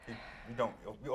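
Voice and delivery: ordinary talk, nothing marked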